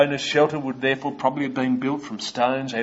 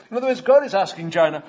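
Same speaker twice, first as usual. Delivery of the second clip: usual speaking voice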